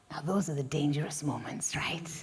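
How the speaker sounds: hushed aside to the audience; whisper. Acted. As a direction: lean in and breathe it as a secret. Narrator leans in to audience.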